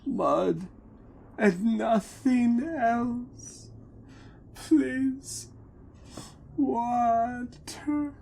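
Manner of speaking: high, slow, forlorn pleading; dejected dwarf. Acted. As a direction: dwarves showing their poverty, begging high and forlorn. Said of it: "And they say".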